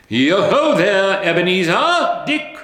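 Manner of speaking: loud, high hearty hail; jolly call. A narrator's reading. Fezziwig hailing his apprentices, loud and jolly. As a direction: call the lads from across the room.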